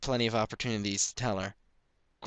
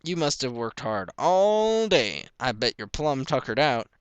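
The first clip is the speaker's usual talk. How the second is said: slow, louder gentle praise; fond father; 4 semitones higher, 5 dB louder than usual. A narrator's reading. Pa proudly praising his weary children. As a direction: praise the tired kids kindly.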